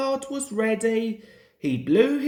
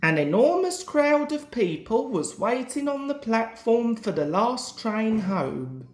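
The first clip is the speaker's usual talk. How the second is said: usual speaking voice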